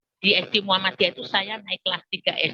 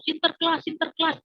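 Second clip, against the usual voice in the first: higher-pitched imitation of children; children calling Santa; 6 semitones higher than usual. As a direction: mimic little children chanting a name. Children mob Santa, calling his name.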